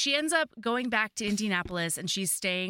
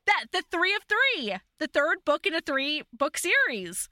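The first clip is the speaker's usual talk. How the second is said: much higher and louder burst of recognition, fading down; excited outburst; 8 semitones higher, 4 dB louder than usual. Real, not acted. Recognizing the next book to her cohost, thrilled outburst. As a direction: blurt it out, thrilled, then settle.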